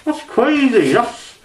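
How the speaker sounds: high, loud, appalled squeals with a laugh; shocked outburst. Real, not acted. Reacting to a graphic fox clip, shocked high squeals. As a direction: recoil in real disbelief at what's on screen.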